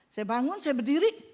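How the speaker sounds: ordinary delivery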